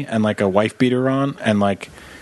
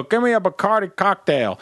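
higher, bossy order; demanding grandpa; 7 semitones higher than usual. Retold or imitated. Grandfather ordering a fake drink at a bar, bossy. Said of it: "He would go".

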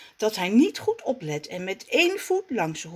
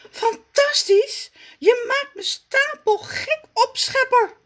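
very high, loud, whining cry; exasperated Zaza; 5 dB louder than usual. Acted. Zaza, fed up with Raf, wails very high. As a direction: wail at him in despair. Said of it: "zegt ze".

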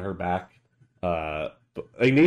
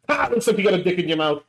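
teasing; 10 semitones higher, 7 dB louder, faster than usual. Real, not acted. Teasing joke at table.

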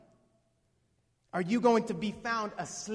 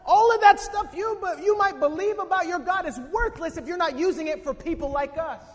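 raised, high-pitched telling-off; loud rebuke; 8 semitones higher, 8 dB louder than usual. Acted. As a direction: scold him at full voice. Voicing the pagan sailor rebuking Jonah.

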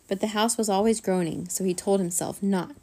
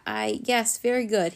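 ordinary delivery, nothing marked

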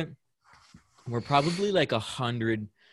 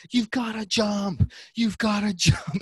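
crowd yelling; 8 semitones higher than usual. Retold or imitated. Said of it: "And everyone's yelling".

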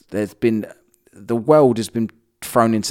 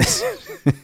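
high laugh burst. Real, not acted. Laughing fit at article.

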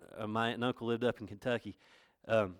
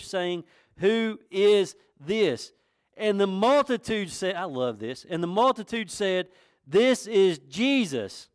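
raised, emphatic sermon delivery; fervent preaching; 7 semitones higher, 6 dB louder than usual. Real, not acted. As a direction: press each phrase home to the congregation. Preaches at full force.

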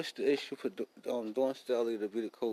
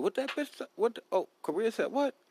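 mocking his mom, pitched way up; outraged mama; 10 semitones higher than usual. Imitating his mama hearing Korea news, high and shocked. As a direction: squawk it like a shocked mother. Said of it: "Mama said".

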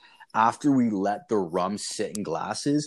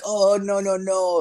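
high, loud imitation of people backing off; hammed-up panicked refusal; mock alarmed refusal; 10 semitones higher, 5 dB louder than usual. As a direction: pitch it way up, waving the request away. Locals recoiling from the request.

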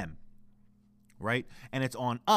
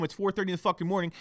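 normal talking voice

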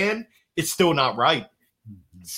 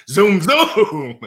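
zoom zoom like a race car, laughing; 3 semitones higher, 5 dB louder than usual. Pretend. To the host, mocking his own driving, zoom sounds. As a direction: mimic a race car speeding past, laughing.

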